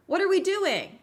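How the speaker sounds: loud, high-pitched exasperation; exasperated outburst. Real, not acted. Streamer fed up with Elgato's instructions, exasperated.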